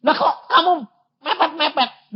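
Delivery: high, laughing mock complaint; laughing question. Pastor imitating his wife, laughing as she asks. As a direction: tease him with a laughing squeal. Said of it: "Aku bilang".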